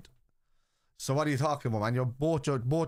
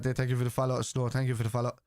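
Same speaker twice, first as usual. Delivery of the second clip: ordinary delivery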